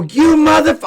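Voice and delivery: swearing up high and loud, cut off mid-word; furious outburst. Retold or imitated. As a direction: blow up at the training partner. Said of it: "I got so mad".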